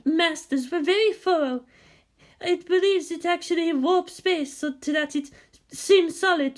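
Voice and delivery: high, airy mystic woman's voice; a mysterious guide explaining, voiced high; Hassia's voice. A narrator's reading. Guide explains the hidden land, high mystic voice.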